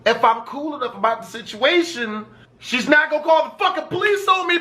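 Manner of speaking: ordinary talk, nothing marked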